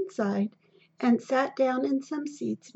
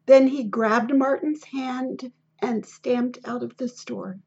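ordinary delivery

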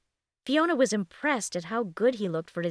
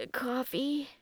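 soft sleepy question, rising higher; 6 semitones higher, 5 dB quieter than usual. A narrator's reading. Just up and wandering into the kitchen, sleepy. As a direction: ask it drowsily and softly. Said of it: "managed a sleepy smile and asked".

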